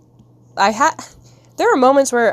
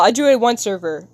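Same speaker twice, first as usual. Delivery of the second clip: ordinary delivery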